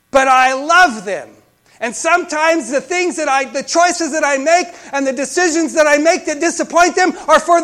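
high, very loud pulpit proclamation; full-force preaching. Acted. Preacher telling the congregation God loves them, at full force. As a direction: drive it out loudly on a high, sustained pitch.

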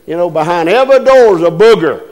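ordinary talk

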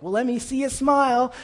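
high, loud mock pep; pesky cheerer-upper. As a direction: imitate someone pushing cheer on the miserable. Mimics pesky cheerer-up of someone down.

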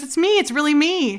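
high-pitched acted insistence; pleading reassurance. Retelling him convincing her he's real, pleading reassurance.

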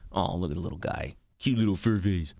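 put-on sweetness, voice dropped soft; mock cooing. Podcaster faking delight at a listener's dog photo, softly. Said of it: "That's what I say".

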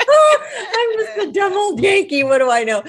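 loud laughing Southern impression; mock Southern. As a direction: ham up a self-mocking Southern accent, laughing. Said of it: "saying it like".

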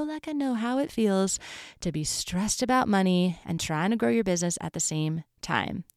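usual speaking voice

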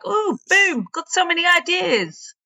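high-pitched mock excitement; hammed-up high, drawn-out enthusiasm; buzzing. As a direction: burst out as if buzzing with ideas. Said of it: "be buzzing ads and be like".

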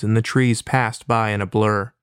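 ordinary talk, nothing marked

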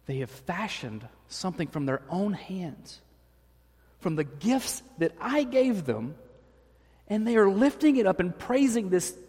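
rising to high outrage on stupid idol; indignant God. Retold or imitated. God vents anger at the people's idol, voice climbing. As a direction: build to a high, disgusted rant. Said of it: "where God says".

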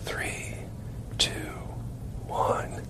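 breathy whispered count down; whispered countdown. Retold or imitated. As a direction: whisper the numbers like a cue from offstage. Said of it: "She's whispering".

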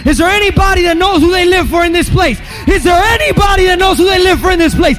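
loud, rising revival preaching; fiery sermon build with a sung lift; preaching at full force. Acted. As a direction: whip the crowd up, ringing out each line. Preacher shouting to the congregation.

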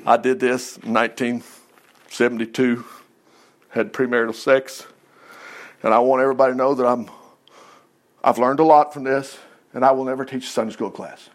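low, soft, sheepish mock confession. Preacher mocking a shamed church member's confession, low and soft. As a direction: mumble it like a shamed sinner forced to confess.

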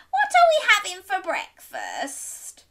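high, loud, keen question; eager granny. Acted. Granny opening the door for breakfast, high and eager. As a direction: ask in a high, excited granny voice.

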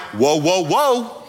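Whoa, whoa, whoa as a relaxed, mildly excited cheer; calm cheer. Retold or imitated. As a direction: answer the alarm with easy excitement. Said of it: "You're like".

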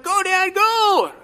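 high, loud excited cheer; retold cheer. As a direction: shout it like a son cheering from the trail. Son cheering dad in race.

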